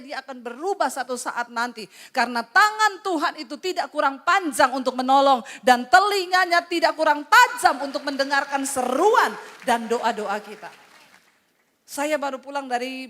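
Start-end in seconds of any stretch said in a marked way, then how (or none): 2.1-10.7 loud, driving pulpit proclamation; full-force preaching. Acted. Preacher promising God hears the wives, full-force shout. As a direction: thunder it out to the congregation.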